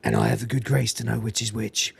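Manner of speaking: low, soft imitation of a stoic hero; Nicholas Angel. Narrator voices Nicholas answering the Reverend, low solemn put-on voice. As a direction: answer low and quiet, in mock gravity. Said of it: "he says".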